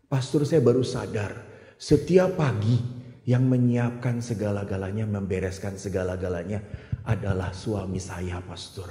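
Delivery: quiet, moved woman. Retold or imitated. Woman confessing her realization.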